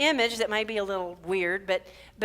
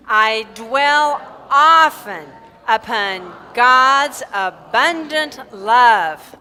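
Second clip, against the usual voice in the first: slow, loud, drawn-out affirmation; group affirmation; 13 dB louder, much slower than usual. Shown as a demonstration. Minister leads congregation in affirmation, slow and loud. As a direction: proclaim every word slowly and fully. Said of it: "let's affirm this together".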